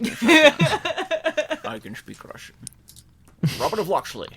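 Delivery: slurred Scottish actor faking Russian; Sean Connery. Acted. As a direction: play the star who can't hide his Scottish accent. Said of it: "you have Sean Connery".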